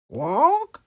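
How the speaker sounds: very high, loud squawk; one loud parrot screech. A narrator's reading. Polly at the supermarket, very high loud squawk. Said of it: "Polly Parrot opened her beak".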